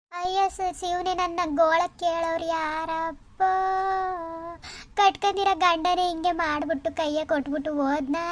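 slow, drawn-out mock lament; fake wailing. Wronged wife staging a plea to the gods, fake wailing. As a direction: wail it out with long tearful line endings. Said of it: "ಕಿರ್ಚು ಕಿರ್ಚು ಅಳು".

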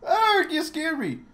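high yelp of surprise; startled. Acted. Player to the angel who just appeared, startled. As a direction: jump at a sudden appearance.

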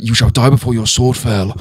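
mock-epic English film accent; lightly hammed fantasy warrior in an English accent; imitated film accent. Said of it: "it's where he's like".

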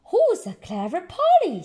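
lightly voiced praising granny. Granny Pig praising her parrot. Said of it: "says Granny".

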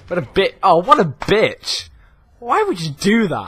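high, loud wail of protest; dismayed outburst. Real, not acted. Gamer wailing at a setback in the game, dismayed outburst. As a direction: cry out in outraged disbelief.

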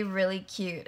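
normal talking voice

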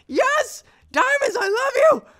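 very high shouting joy with a cry in it; overjoyed cry. Real, not acted. Gamer shrieks with joy at first diamonds. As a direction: scream your love for the diamonds.

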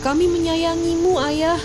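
high, desperate shouting. Acted. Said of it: "dia berteriak-teriak kepada ayahnya".

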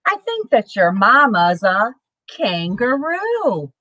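plain reading voice

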